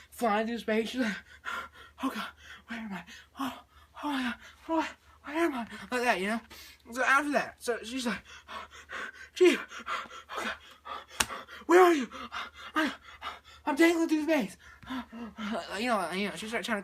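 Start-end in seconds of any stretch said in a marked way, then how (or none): 2.0-5.7 breathless, frightened mumbling between gasps; panting, lost and scared, played up; panicked gasping. Astronaut adrift alone in space, panicked gasping. 9.3-14.6 hammed-up breathless call for help; panicked calling. Astronaut calls Chief while dangling through space, panicked.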